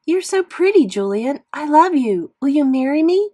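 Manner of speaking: a made-up admirer gushing over Julian; swooning girl voice. Acted. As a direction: ham up a gooey crush. Fake love note from Beulah.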